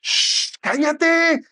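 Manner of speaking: high, loud, exasperated shushing; acted annoyed shout to silence someone; scolding crowd hushing. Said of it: "lo reprendían para que se callara".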